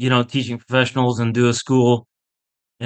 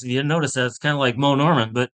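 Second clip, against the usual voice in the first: usual speaking voice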